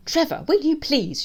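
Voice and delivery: high, loud, slow scolding; exasperated blurt. A narrator's reading. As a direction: blurt it crossly at the dog. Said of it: "she blurts".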